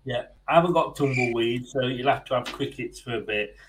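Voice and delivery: usual speaking voice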